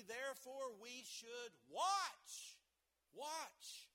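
watch slow and loud; stress on watch. Acted. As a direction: lean hard on watch.

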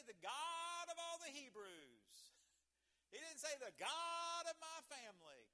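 high, loud build with "God" held long and belted; full-force pulpit cry; ear-hurting preacher shout. Real, not acted. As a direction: thunder each contrast, stretching "God". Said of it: "I didn't want to hurt your ears".

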